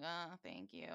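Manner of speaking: low, soft, awkward mumble; mock half-hearted thank-you; reluctant thanks. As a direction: imitate a brother going limp in a hug. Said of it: "And he's like".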